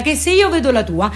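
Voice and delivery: ordinary talk, nothing marked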